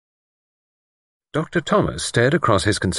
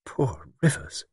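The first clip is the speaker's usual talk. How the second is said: soft, slow and higher, a shiver in it; low voice; 7 semitones higher, 7 dB quieter, slower than usual. A narrator's reading. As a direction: say it low and slow, barely there. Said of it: "said in a low voice".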